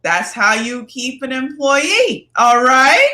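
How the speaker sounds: training video voice. Retold or imitated. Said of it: "it's like".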